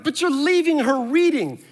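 put-on yelling across the room at a kid who walked off; retold angry shout. Dad in the kitchen scolding his son for leaving, shouting.

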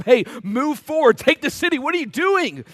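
high-pitched mock yell; acted shout, pitch climbing sharply; retold screaming. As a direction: scream orders from the back, imitating an exasperated colonel. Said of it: "screaming at him".

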